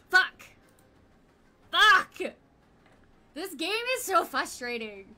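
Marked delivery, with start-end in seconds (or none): 0.1-2.2 loud angry curse; frustrated shout. Real, not acted. Streamer swearing loudly at her game, frustrated.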